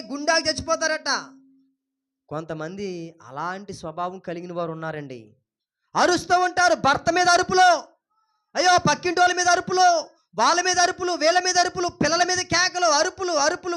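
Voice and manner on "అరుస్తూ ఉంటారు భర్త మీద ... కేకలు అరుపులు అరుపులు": high, loud pulpit tirade; impassioned, raised-voice harangue; railing at shouters. Real, not acted. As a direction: fire off the list at full voice. Preacher rails at people who yell.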